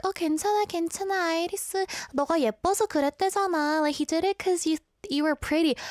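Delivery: mock reassurance in a lifted voice; soothing teachers. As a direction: brush it off like a cooing teacher. Voices teachers soothing a bitten child.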